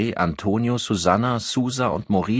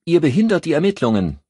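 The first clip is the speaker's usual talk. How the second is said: loud, fast, higher angry bark; snapping; 11 semitones higher, 7 dB louder, faster than usual. A narrator's reading. Sophia snapping at her colleagues outside the door, furious. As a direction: snap it at them in fury. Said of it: "blaffte sie".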